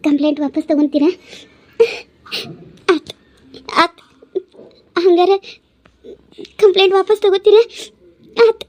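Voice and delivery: high-pitched tearful asking; crying. Acted. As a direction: ask in halting words through grateful tears. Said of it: "ಮತ್ತ್ಯಾಕ್ ಅಳ್ತೀರಿ". (ಅಳುತ್ತಾ ಕೇಳುವ ಧ್ವನಿ)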